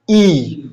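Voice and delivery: ई drawn out long; long ई demo. Shown as a demonstration. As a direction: stretch the ई. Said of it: "थोड़ा ज्यादा खींचना है". (ई को खींचकर बोलना)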